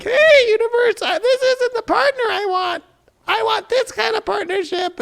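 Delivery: very high, sing-out appeal; hailing the universe. Acted. Sitter asking the universe for a partner, high hammed-up plea.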